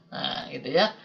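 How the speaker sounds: usual speaking voice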